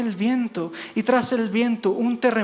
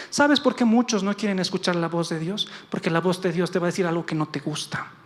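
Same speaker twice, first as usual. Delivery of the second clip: normal talking voice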